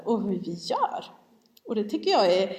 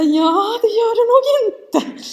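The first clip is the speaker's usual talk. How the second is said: high, loud, drawn-out mock disbelief; hammed-up outburst rejecting the reassurance; loud retort; 12 semitones higher, 9 dB louder, slower than usual. Mamma contradicting youngest child's claim of safety, high loud retort.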